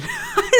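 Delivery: short high, light laugh. Real, not acted. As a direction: laugh briefly at something mean. Laughs at the mean line.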